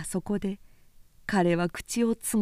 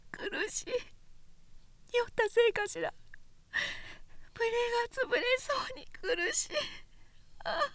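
high, soft, rushed lament; choking on jealousy and pain; anguished inner voice; 14 semitones higher, 6 dB quieter, faster than usual. A narrator's reading. Jealous drunk woman suffering.

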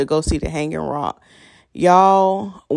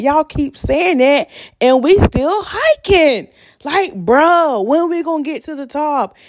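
higher, drawn-out fed-up complaint; exasperated whine; 9 semitones higher than usual. Retold or imitated. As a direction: whine like you've been lied to all day. Exasperated inner reply while hiking.

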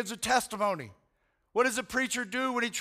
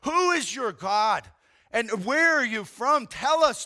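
louder, slow accusing questions; alarmed sailors; 4 dB louder, slower than usual. Retold or imitated. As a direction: demand answers, scared and angry. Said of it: "They're like".

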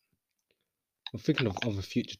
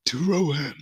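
raised, lightly put-on documentary voice; David Attenborough impression; 6 semitones higher, 4 dB louder, slower than usual. Shown as a demonstration. Host resuming an impression after laughing, mock documentary narrator. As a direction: finish the mock narration in a higher voice. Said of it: "my David Attenborough impression".

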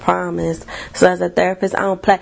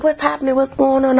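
alarmed staff; 6 semitones higher, faster than usual. Retold or imitated. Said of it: "And they like".

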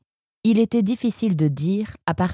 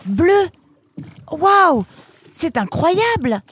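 amazed delight; 13 semitones higher, 5 dB louder, slower than usual. Acted. Nemrod sees blue water.

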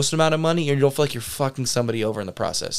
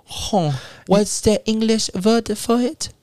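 high, hammed-up German accent, indignant; mock German insult; 7 semitones higher than usual. Mocking Bavarian snobs in a German accent. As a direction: sneer like a fussy old German. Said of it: "Did he go over the Germans who were like".

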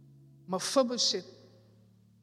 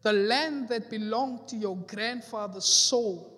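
loud scripture declaration; 6 dB louder than usual. Acted. Preacher declaring scripture loudly.

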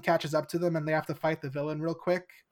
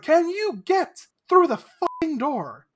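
much higher and louder yell; exasperated shout; 11 semitones higher, 7 dB louder than usual. Retold or imitated. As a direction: yell in frustration. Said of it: "Carter's like".

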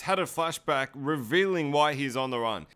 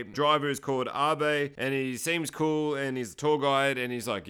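ordinary delivery

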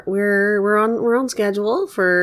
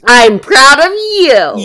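excited; 7 semitones higher, 12 dB louder, slower than usual. Real, not acted. Answering partner's praise.

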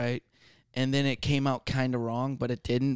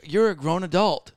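incredulous, louder and higher; exasperated retort; 5 semitones higher, 5 dB louder than usual. Retold or imitated. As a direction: scoff at a grown adult. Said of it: "And I'm just like".

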